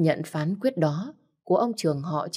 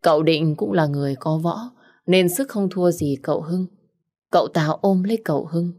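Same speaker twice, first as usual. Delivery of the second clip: ordinary delivery, nothing marked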